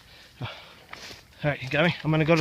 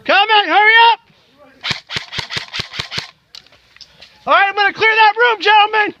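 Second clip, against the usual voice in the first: shouted command; 6 semitones higher than usual. Real, not acted. Leader yelling team forward.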